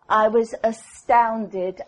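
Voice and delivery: ordinary talk, nothing marked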